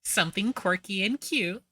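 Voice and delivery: high, sing-song mock cute; mock her in a girly lilt. Mocking the storyteller's tone.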